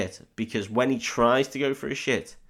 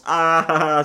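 loud, higher teasing "ah"; drawn-out mock-gleeful taunt; mocking jeer; 6 semitones higher, 8 dB louder than usual. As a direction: jeer at him, taking the piss. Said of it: "starts taking the piss out of him".

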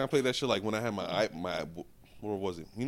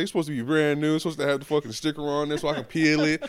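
bougie mom voice; 6 semitones higher, 7 dB louder than usual. Retold or imitated.

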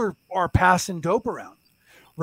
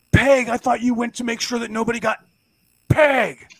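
louder, higher mock reproach; retold scolding dad; 4 semitones higher, 7 dB louder, slower than usual. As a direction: catch her out, like an appalled husband. Acted dad scolding his wife.

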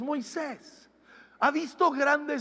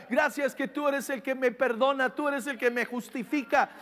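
ordinary talk

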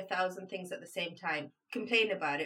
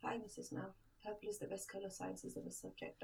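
barely audible pupil voice; imitating meek student; 15 dB quieter than usual. Brainwashed student admitting it to the teacher, very quiet. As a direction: say it very softly, almost under your breath. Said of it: "could you raise your voice a little bit".

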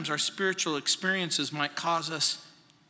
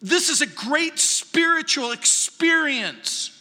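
higher, louder, slow triumphant delight; Peter's elation; 6 semitones higher, 4 dB louder, slower than usual. Pretend. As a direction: savor it like Peter feeling he's arrived. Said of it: "He's thinking".

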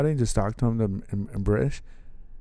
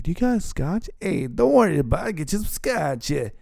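mock Italian old-timer; 9 semitones higher than usual. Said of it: "looked at me like".

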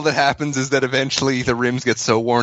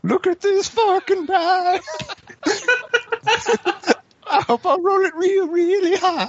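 very high squeaky voice with laughs; falsetto laughing. Acted. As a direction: squeal it out giggling.

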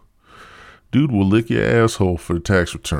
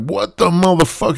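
much higher, louder startled yell; shocked outburst; 10 semitones higher, 4 dB louder than usual. Retold or imitated. Man tasting Squirt mid-act, high shocked yell. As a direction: blurt it out in disgusted shock.

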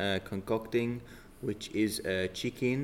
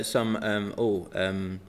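ordinary delivery

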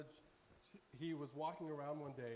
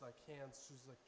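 ordinary delivery

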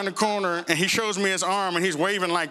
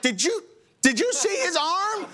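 high, disbelieving question; incredulous; 10 semitones higher than usual. Retold or imitated. Pastor to the hospital clerk, high incredulous question. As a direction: ask in disbelief.

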